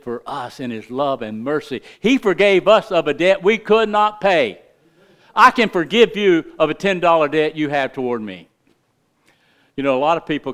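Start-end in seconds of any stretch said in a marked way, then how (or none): 2.0-8.5 louder, emphatic preaching; full-force preaching. Acted. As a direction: press the point from the pulpit.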